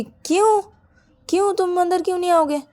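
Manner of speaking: high-pitched incredulous demand; indignant disbelief. A narrator's reading. Bride asking why partner won't come inside, incredulous. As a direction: demand an answer, voice jumping up.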